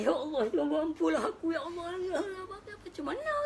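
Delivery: high, loud pleading sob to God; crying prayer. Retold or imitated. Worshipper crying out to Allah, loud high sobbing plea. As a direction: beg God for forgiveness through tears. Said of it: "Ni dia menangis".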